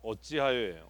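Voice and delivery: ordinary delivery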